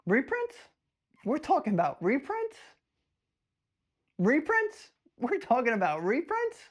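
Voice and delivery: high rising incredulous questions; playful exaggerated surprise at the rumor; mock disbelief. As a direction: act stunned that anyone says reprints.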